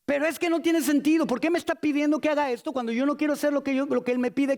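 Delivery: high-pitched, agitated complaint; whining protest. Acted. Believer complains about what God asks, high exasperated protest.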